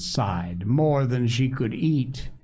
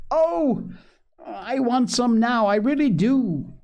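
put-on very high, childlike craving; eager pleading; 12 semitones higher than usual. A narrator's reading. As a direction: plead like a little boy for sweets. Small boy begging for candy.

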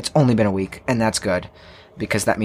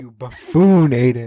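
pompous mock scolding; 4 dB louder, slower than usual. Echoing the mock insult.

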